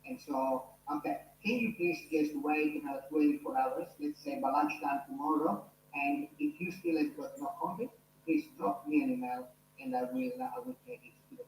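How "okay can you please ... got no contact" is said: ordinary delivery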